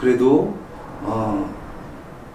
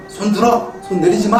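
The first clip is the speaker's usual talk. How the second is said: mock stern command, pitched higher; threatening tone; 7 semitones higher than usual. Father punishing his kids holding pillows, threatening order. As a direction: scold them like a strict father, half-acted. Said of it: "위협적인 말투로".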